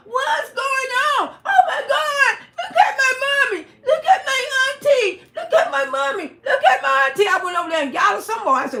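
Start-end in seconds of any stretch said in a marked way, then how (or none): 0.1-7.3 high, loud frantic screaming; panicked girls imitated. [name] mimicking the girls screaming at the fight. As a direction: play the terrified girls shrieking. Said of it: "the two little hoes talking about".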